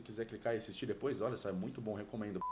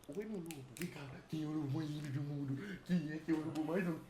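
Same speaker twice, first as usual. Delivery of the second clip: pet talk. Real, not acted. Cooing over a pet wolf.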